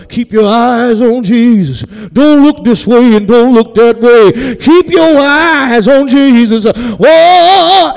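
loud, high, drawn-out preaching climax; tuning-up preacher. Shown as a demonstration. Preacher showing what the congregation expects, tuning-up preacher. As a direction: shout it slow and sustained, near chanting, into a whoop. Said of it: "start tuning up with the organ".

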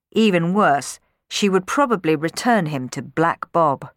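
plain reading voice

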